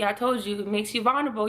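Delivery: usual speaking voice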